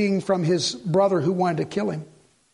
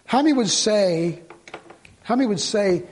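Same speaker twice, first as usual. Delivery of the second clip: usual speaking voice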